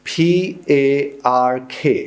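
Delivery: slow letter-by-letter spelling; spelled out. Shown as a demonstration. Teacher spelling a word for students, slow and deliberate. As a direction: spell each letter out slowly. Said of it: "एक लफ्ज़ है".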